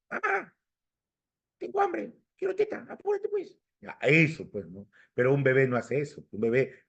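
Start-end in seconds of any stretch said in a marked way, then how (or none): 0.1-3.6 put-on high, small baby voice; put-on little baby voice, much higher; talking baby. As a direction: squeak it out like a demanding baby. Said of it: "imagínate un bebé que diga".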